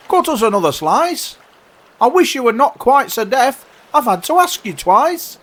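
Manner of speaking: high, loud, peevish voice; complaining carpenter. Acted. Carpenter demanding bread of walrus, peevish.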